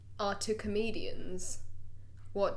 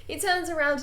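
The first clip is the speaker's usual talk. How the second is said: ordinary talk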